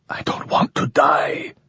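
high, breathless whisper; a wounded man whispering his fear of death. Acted. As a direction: whisper it like a dying plea. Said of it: "the other whispered".

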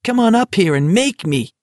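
high, shrill mocking cry; shrill taunt. A narrator's reading. Barkovich keeps taunting.

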